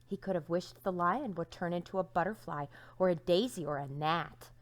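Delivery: ordinary delivery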